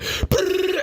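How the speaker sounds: high buzzing brrr sound; imitated tub rumble. Tub shaking the house, high mock buzz. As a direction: buzz like a rattling jacuzzi motor.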